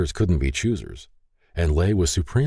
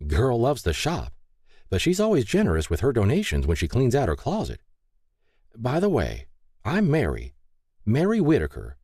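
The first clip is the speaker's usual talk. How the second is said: lightly voiced chatty helper, pitched higher; friendly Mary; 7 semitones higher than usual. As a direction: introduce yourself sociably in a higher voice. Narrator voices Mary's chatty introduction.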